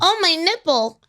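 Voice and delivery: hammed-up coy, oopsie-daisy act; put-on ditzy flirt. Woman joking with a cop who pulled her over, mock coy. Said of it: "we put it on sometimes".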